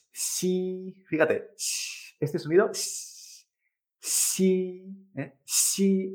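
"Xi" as a hissed "shee" through a smile; smiling X. Shown as a demonstration. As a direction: draw out the hiss of "Xi" with spread lips. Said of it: "es más sonriendo".

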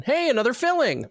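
high, upbeat announcement; imitating cheery dentist. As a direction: break good news in a sing-out voice. Retold dentist announcing second filling.

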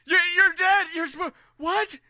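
very high, loud stammered shock; freaking out. Acted. Gate guards believing Colm was dead, freaking out. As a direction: squeal it in startled disbelief. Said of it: "freaking out 'cause they're like".

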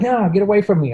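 put-on high, pushy little-boy voice pushing the helper away; a small kid snapping at a grown-up to back off; bratty little kid. Kid refusing help, voiced.

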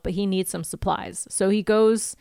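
usual speaking voice

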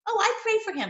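mocking quote. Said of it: "mocks enemies' claim".

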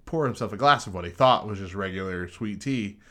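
ordinary talk, nothing marked

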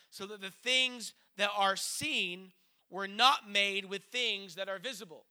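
slow, loud, measured Bible reading; scripture reading. Acted. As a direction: read the verse out slowly and firmly to a congregation. Reads scripture aloud.

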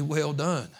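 ordinary talk

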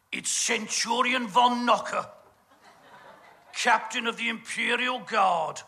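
ordinary talk, nothing marked

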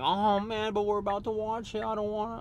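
high-pitched whiny kid imitation; mock whining. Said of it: "he starts whining like this".

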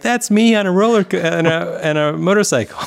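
gleeful, high-pitched mock boasting; Ryan Reynolds. Host imitating an actor seeing himself onscreen, excited. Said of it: "looking at a big screen where he's like".

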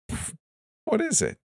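high, incredulous scoff; snort, then ask in high-pitched disbelief; derisive, high-pitched questioning. A narrator's reading. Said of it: "I snorted".

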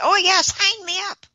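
high, loud mock enthusiasm; mock eager. Teasing the co-host about signing up for the study, mock eager. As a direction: play the over-keen volunteer, sarcastically. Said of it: "called them up right away and said".

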